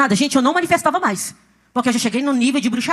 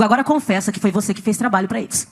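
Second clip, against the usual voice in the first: low, grave order to confess; imitating the voice that speaks to her; commanding inner voice; 6 semitones lower than usual. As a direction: press it home in a lowered pitch. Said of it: "a voz fala comigo".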